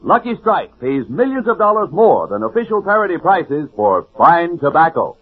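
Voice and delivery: ordinary talk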